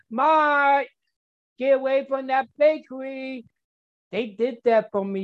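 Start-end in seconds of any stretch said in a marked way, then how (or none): 0.1-3.4 hollering at him to stay away; calling out warning. Retold or imitated. Friends on the phone warning Mark off the bakery, calling out high. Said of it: "all them people calling me up".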